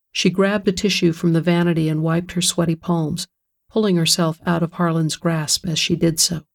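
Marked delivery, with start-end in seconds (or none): none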